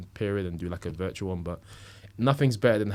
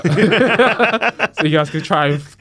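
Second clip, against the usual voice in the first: laughing; 11 semitones higher, 13 dB louder, slower than usual. Real, not acted. Laughing while joking about name.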